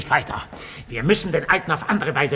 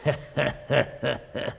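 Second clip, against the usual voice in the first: villain chuckle; 2 semitones lower, 6 dB quieter than usual. Acted. Villain gloats over his plan.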